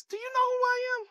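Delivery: high-pitched, indignant mock offense; upset angel. Angel Gabriel to doubting Zacharias, upset and affronted. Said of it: "imagine the angel got upset".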